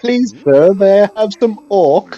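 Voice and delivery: high, loud, slow, pleading urchin; mock Oliver Twist plea. Oliver Twist-style parody of the orc.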